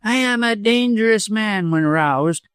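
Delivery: high-pitched, self-satisfied villain; mean lawyer, boastful. A narrator's reading.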